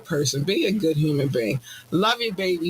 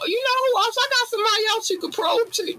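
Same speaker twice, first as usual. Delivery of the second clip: much higher, sly mock-helpful voice; snitching friend. A friend offering aliens a referral, in a high mock-helpful voice. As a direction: squeak it like a snitch offering others up. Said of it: "ninjas will give a re".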